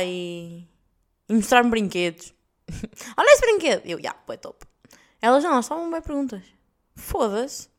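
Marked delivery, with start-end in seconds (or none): 3.2-3.8 high, loud imitated child; boy showing a toy. Boys showing their toys. 4.0-4.7 hushed, unvoiced aside; offhand approval. Unimpressed reply to boys' toys.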